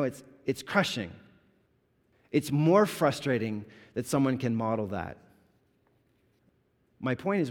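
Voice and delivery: ordinary talk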